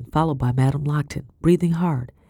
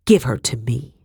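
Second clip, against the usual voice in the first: cold menace; 2 semitones lower than usual. Acted. Madame's eyes narrow.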